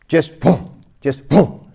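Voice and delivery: loud, punchy repeated command; master's booming command. Retold or imitated. Master commanding his student, loud and booming. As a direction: bark it out like the master teaching. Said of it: "And he said".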